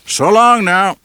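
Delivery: calling after them. A narrator's reading. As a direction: shout it after them as they leave. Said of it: "the big man called after them".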